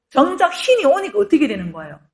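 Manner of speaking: ordinary talk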